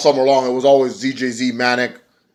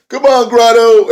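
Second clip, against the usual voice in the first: much higher, louder mock commentator call; imitating the Pope; 11 semitones higher, 9 dB louder than usual. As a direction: cheer the name like a hyped commentator. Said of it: "that's the Pope, uh, calling him Grotto".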